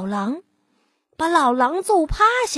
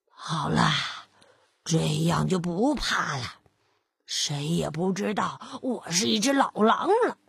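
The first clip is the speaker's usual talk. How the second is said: put-on old wolf sneaking; 6 semitones lower than usual. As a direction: hush it like a wolf hoping not to be caught.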